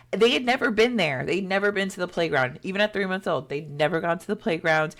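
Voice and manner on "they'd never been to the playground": normal talking voice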